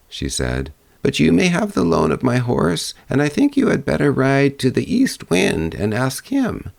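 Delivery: put-on a high old crone sending her to the east wind; third old woman. Old woman sends the girl to the east wind, high voice.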